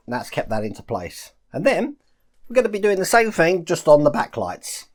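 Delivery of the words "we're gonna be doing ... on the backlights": ordinary delivery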